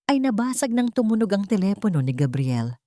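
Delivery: plain reading voice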